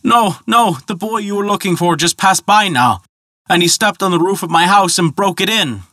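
high, pleading wail of a muskrat faking tears; crying bitterly. Acted. Muskrat pleading with the Dragon Man, hammed-up high tearful wail. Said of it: "began to cry bitterly and said".

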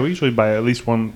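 usual speaking voice

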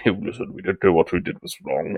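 a touch of a solemn politician; mock formal apology; mock Tory apology. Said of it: "Tory-style apology".

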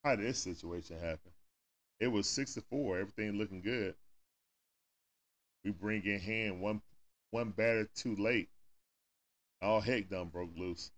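ordinary talk

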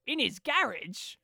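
mock an actor's American accent. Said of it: "says in an American accent".

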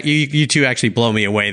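ordinary talk, nothing marked

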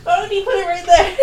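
high, loud question through laughter; laughing protest. Real, not acted. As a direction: complain while cracking up.

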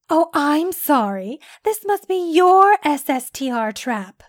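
drip with sarcasm; sarcastic. A narrator's reading. Doctor mocks the man's claim to her trap, sarcastic. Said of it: "her tone oozing with sarcasm".

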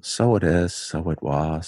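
low Irish menace; scary, understated Irishman; quiet Irish accent. Shown as a demonstration. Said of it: "that speaks very quietly".

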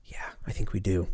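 soft unvoiced whisper. Real, not acted. Vlogger deciding to dive into the idiom, whispered. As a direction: murmur it to yourself.

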